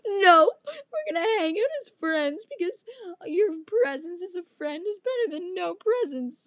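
high, sobbing wail imitating a boy; exaggerated tearful whine; mock crying. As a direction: blubber it through hammed-up sobs. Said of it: "literally crying".

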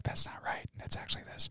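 unvoiced whisper; breathy, secret whisper. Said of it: "He's gonna whisper".

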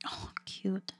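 whispered aww. Real, not acted. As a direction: breathe it out softly. Reacts to Yoshi cult.

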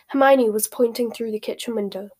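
ordinary delivery

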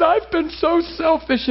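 high, slow imitated crying; tearful wife. Wife confesses to husband through tears, high imitated crying. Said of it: "gasping for breath and crying".

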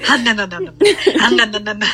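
low groaning no no no; mock disgusted moans. Real, not acted. Friend reacting to the smut with disgusted groaning no's. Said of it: "Don't make those sounds".

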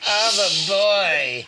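put-on drunk slurring. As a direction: slur it like you're hammered. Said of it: "an outtake of you going".

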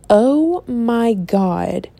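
drawn-out slow oh my God; slow awed gasp. Retold or imitated. Starting to read Twilight, slow amazed gasp. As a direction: let it hang, amazed. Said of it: "I was like".

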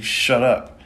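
put-on boy yelling at his teacher; frustrated yell. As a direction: snap back in frustration. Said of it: "yells out in frustration".

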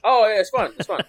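loud, high insisting; emphatic insisting. Real, not acted. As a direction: insist it really is fun. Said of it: "a fun story about stats?".

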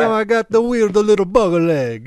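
high mock Italian accent. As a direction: complain like a comic Italian. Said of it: "the Italian accent is approaching".

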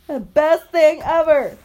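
loud, high, drawn-out delight; big slow exclamation of delight; overjoyed. Real, not acted. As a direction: gush slowly and loudly with joy. Overjoyed at toddler's word.

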